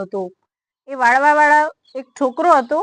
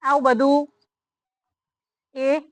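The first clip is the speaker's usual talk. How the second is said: ordinary delivery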